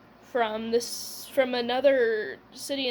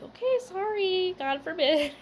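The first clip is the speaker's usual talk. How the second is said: sarcastic mock apology; 8 semitones higher than usual. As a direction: throw her words back at her, mockingly. Mocking mom's reply back.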